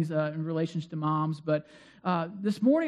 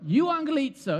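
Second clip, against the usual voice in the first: higher-pitched, playful Italian lilt; Italian accent; 6 semitones higher, 4 dB louder than usual. Shown as a demonstration. Preacher leading the congregation in saying it, playful Italian accent. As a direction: say it with a little Italian flourish. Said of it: "do a little Italian accent".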